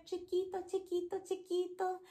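high, soft, tiny voice; small voice. Shown as a demonstration. Teacher showing chiquito in a tiny high voice. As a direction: squeak it small and light. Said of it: "big and small".

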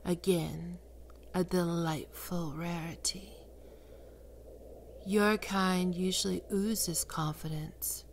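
ordinary talk, nothing marked

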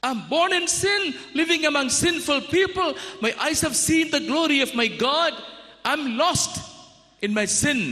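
Isaiah cries out before God; crying out. Acted. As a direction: cry out overwhelmed by holiness. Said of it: "Isaiah cried out".

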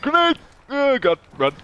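alarmed shout of warning; high panicked yell, run for cover; being chased. Real, not acted. Grenade thrown at him.

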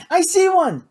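put-on high, loud excited young passenger; excited sighting. A narrator's reading. Kate at her field glasses spots one, high excited call.

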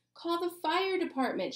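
high urgent shout. A narrator's reading. Alexia calling for help in the fire, high shout. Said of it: "shouted Alexia".

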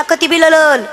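put-on कती बी ललल with l for the r sounds, a childish lisp; lisping boy. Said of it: "म्हणला".

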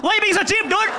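high, loud nagging guest; mock whiny complaint. Guest nagging the host for being cheap, high and loud. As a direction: whine at the stingy host.

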